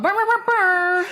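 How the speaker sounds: high lip-trill noise; celebratory trill. Real, not acted. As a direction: trill like a fanfare.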